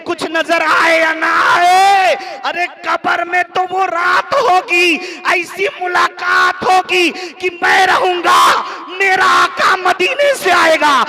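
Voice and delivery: a preacher at full force shouting his longing; impassioned shout. Acted. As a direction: cry it out to the whole hall. Impassioned climax of Bilal's words.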